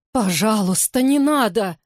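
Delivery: put-on desperate pleading child. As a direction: beg them not to. Child begs.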